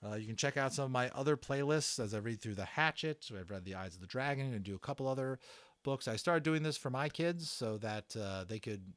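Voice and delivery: usual speaking voice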